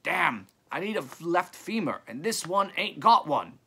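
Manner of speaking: loud, high-pitched call down a hill; McCabe, calling out. A narrator's reading. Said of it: "a voice very like McCabe's called out".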